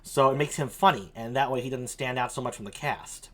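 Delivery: ordinary talk, nothing marked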